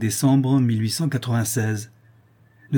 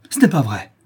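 quick, raised-voice denial; indignant cry; 3 semitones higher, 5 dB louder than usual. A narrator's reading. Accused Marion denies the knife to Maurice, crying out. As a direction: cry out the denial, louder and sharper. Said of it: "cria monsieur Marion".

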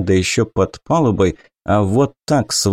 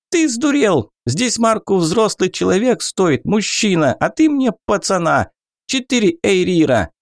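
high, outraged goblin; 9 semitones higher than usual. Acted. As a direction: scoff like an indignant goblin, pitched high.

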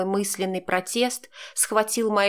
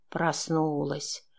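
low, slow, softer murmur with a chuckle; soft rustling whisper; 5 semitones lower, 5 dB quieter, much slower than usual. A narrator's reading. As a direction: breathe it slowly, amused. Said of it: "прошелестел прямо в губы со смешком".

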